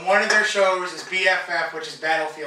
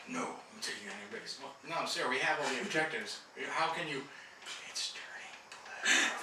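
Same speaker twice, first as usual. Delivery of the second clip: whispering; 5 semitones lower, 13 dB quieter than usual. Retold or imitated. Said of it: "acting out the episode".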